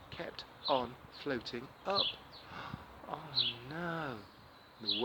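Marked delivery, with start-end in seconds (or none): 2.1-3.0 gasp. Acted. Anansi floats up.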